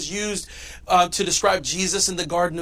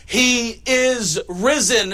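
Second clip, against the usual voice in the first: louder, higher, very slow proclamation; triumphant proclamation; 5 semitones higher, 4 dB louder, much slower than usual. Shown as a demonstration. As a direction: proclaim it slowly like a victory. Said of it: "Here's the announcement".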